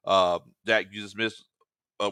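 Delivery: ordinary talk, nothing marked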